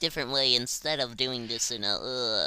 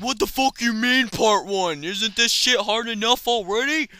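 higher, louder mock complaining viewer; whiny imitation of outraged viewers; mock viewer whine; 9 semitones higher, 5 dB louder than usual. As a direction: play the fed-up fans groaning. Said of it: "I bet you guys are like".

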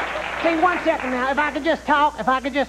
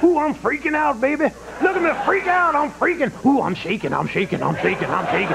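sarcastic mock panic. Clinton mocks Conan's concern about the polls, sarcastic. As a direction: pretend to tremble with worry. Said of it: "from your sarcastic response".

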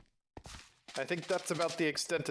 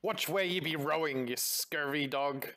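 mock-gruff pirate telling off the crew; pirate scolding; slower than usual. Pirate scolding a careless rower, mock pirate rebuke. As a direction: draw out the insult like an old sea-dog.

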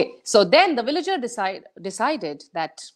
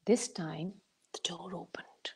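soft, secret storyteller hush; hushed suspense; 3 semitones lower, 15 dB quieter than usual. A narrator's reading. Storyteller hushing children before the door opens, whispered. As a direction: whisper it as the door creaks open. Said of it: "suspense before door opens".

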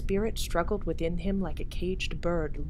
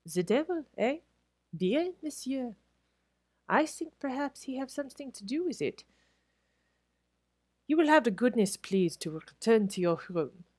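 put-on lightly French-accented, higher waiter voice; a hint of a French head waiter, polite but firm; 7 semitones higher than usual. Pierre the head waiter refusing Crutchley, French accent.

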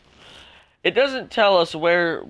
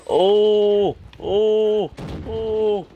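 rising excitement, higher and louder; excited oh oh oh; 6 semitones higher, 5 dB louder, much slower than usual. Real, not acted. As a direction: gasp with hope as the streak nears. About to get last scorestreak.

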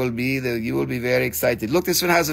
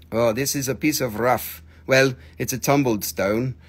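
ordinary talk, nothing marked

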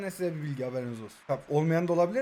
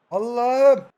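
loud, higher cry to God; exasperated exclamation; 4 semitones higher, 6 dB louder than usual. Real, not acted. Player reacts to play going wrong, exasperated. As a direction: let out a frustrated appeal.

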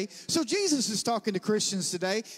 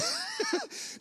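very high mock cackle; obnoxious laugh; 3 dB quieter than usual. Preacher re-enacts laughing at the classmate, high obnoxious laugh. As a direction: cap it with a put-on cackle. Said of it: "I was laughing obnoxiously".

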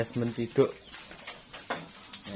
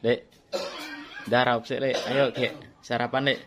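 loud, coaxing call; 3 semitones higher, 7 dB louder than usual. Real, not acted. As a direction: call him over to breakfast. Calling uncle to breakfast.

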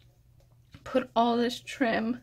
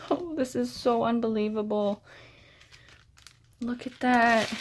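ordinary talk, nothing marked

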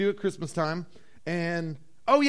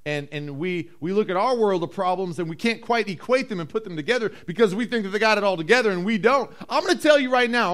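ordinary talk, nothing marked

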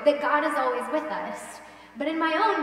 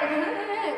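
laughing; 7 semitones higher than usual. Real, not acted. Laughing at her story.

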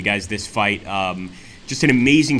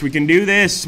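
louder rally cry; rallying shout; 7 semitones higher, 7 dB louder than usual. Real, not acted. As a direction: shout it to lift the troops.

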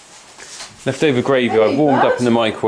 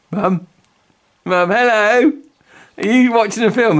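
calling out; 8 semitones higher than usual. Real, not acted. Calling Mum who doesn't answer.

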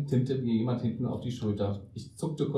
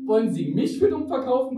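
high, pushing back with für stressed; countering; 11 semitones higher, 5 dB louder than usual. A narrator's reading. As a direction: throw the same line right back, higher. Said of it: "konterte ich". (kontert, höher, Betonung auf für)

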